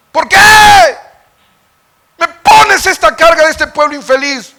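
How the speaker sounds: high shouted protest; anguished outcry. Acted. Preacher voicing Moses protesting his burden, shouting. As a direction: shout the burden back at God.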